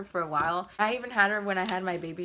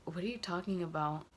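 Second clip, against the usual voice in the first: whispered, softer and unvoiced; hushed denial; 6 dB quieter than usual. [name] whispering her denial across the table. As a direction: deny it under your breath.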